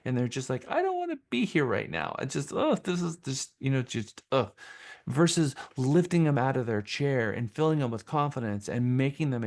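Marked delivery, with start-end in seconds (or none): 0.7-4.5 mock squirming audience member, pitched up; uncomfortable listener. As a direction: act out an audience wanting to escape. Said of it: "When you're not confident, they don't feel comfortable".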